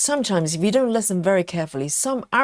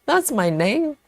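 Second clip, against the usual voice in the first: uncles puzzled; 6 semitones higher, slower than usual. Pretend. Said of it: "And the uncles are saying".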